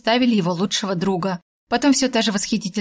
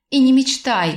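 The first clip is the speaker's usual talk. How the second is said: loud, higher growled refusal; growled warning; 5 semitones higher, 4 dB louder than usual. A narrator's reading. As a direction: snap it back, nearly growling. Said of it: "практически прорычала я".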